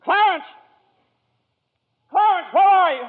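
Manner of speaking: desperate shout into the night; frantic searching call. Acted. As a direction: call for him as loud as you can. Searching, calling out for Clarence.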